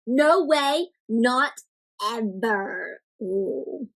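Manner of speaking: ordinary delivery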